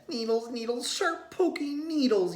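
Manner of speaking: high, loud yell. A narrator's reading. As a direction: cry out about the needles. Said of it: "yelled Tate".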